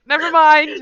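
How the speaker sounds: loud, high hasty retraction; abrupt backing down. Acted. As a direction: voice the character giving up out loud. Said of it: "She's like".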